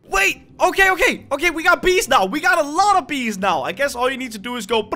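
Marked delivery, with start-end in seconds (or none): none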